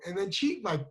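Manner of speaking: normal talking voice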